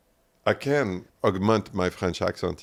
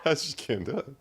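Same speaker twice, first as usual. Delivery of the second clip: laughing; 5 dB louder than usual. Real, not acted. Laughing apology.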